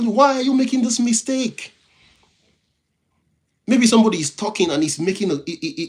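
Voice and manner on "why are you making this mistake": high, loud cry of why; exasperated shout. Retold or imitated. Host shouts at people making obvious mistakes, exasperated. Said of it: "you shout".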